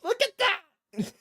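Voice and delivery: high, drawn-out gleeful crow; high-pitched laughing delight; high, slow gleeful crow. Real, not acted. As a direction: stretch it out, crowing high. Mocking excited about a man's haircut.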